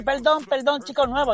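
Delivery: high, mock-foreign boss voice; boss's apology. Waiter imitating boss apologising to the customer, high pitched. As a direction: squeak out the apology. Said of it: "y la china que dice".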